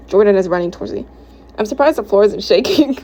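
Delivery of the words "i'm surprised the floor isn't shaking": usual speaking voice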